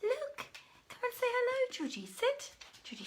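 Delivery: ordinary talk, nothing marked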